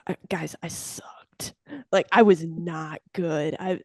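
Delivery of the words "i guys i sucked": whispered confession to the group; sheepish whispered aside. Real, not acted. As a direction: drop to a secret whisper for the admission.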